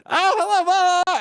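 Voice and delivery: high, loud, chipper put-on greeting; mock chipper greeting. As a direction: fake a bright cheerful hello for fans. Mock cheerful convention greeting.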